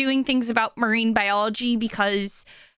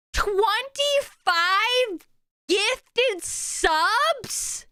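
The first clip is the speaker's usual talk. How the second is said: high, long-drawn cries of amazement; stunned disbelief; 8 semitones higher, much slower than usual. Real, not acted. Streamer reacting to twenty-five gifted subs, stunned. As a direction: stretch the words out in real shock, high.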